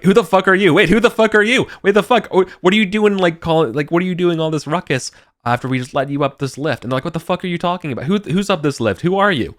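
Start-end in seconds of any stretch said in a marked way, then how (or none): 0.0-6.8 high, loud, indignant yelling at strangers; heated confrontation between rival gangs; angry NPC shouting. Acted. GM voicing angry NPC argument. 7.0-9.5 high, fast, baffled shouting back; indignant retort. Acted. GM voicing second gang reply.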